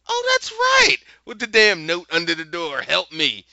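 sarcastically mimicking Dorothy's plea, very high and loud; mocking. Speaker mocks Dorothy's claim.

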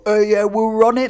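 high, loud mock-Welsh lilt; bad Welsh accent. Clubber in the Valleys boasting, bad Welsh accent. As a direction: put on a hammed-up Welsh accent. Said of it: "whatever accent the Welsh do".

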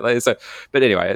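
ordinary delivery

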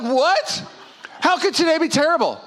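high-pitched mock outrage; indignant disbelief. Husband answering his wife's complaint, incredulously. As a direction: protest as if wrongly accused. Husband protests in retold quarrel.

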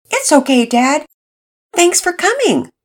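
put-on a hopeful kid pleading with his mom; teen son.

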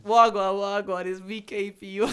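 loud mock presenter shout-out; big up yourself like a radio host; hype intro. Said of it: "properly introduce myself".

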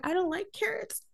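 small, high, soft whine; whiny. Retold or imitated. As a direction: imitate a picky, pouting complaint. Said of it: "she's still whining".

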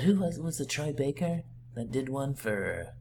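Joker voice. Acted. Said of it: "Are you gonna do this the whole time?".

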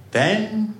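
understated sad mother; a single word with mild sadness. A narrator's reading. Mother answering her child, sadly. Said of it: "said the mother sadly".

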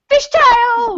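high, loud comic cry; mock exclamation. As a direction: call it out in mock alarm. Acting out Vettel's skid.

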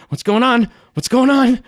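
high, loud, urgent questioning; panicked Judas. Acted. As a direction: grab someone and demand answers in a high voice. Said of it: "Judas grabs your elbow and says".

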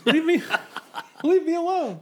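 laughing protest. Real, not acted. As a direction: laugh through it. Laughing off the teasing.

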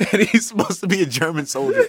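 high-pitched, laughing retelling; amused disbelief through laughs. Real, not acted. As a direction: barely hold back laughter. Cracking up retelling the film.

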